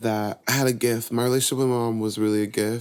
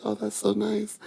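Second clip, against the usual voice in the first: much higher, softer, sweet mom voice; gentle mom; 11 semitones higher, 3 dB quieter than usual. Retold or imitated. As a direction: coo it like a pleased mother. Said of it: "Mom was like".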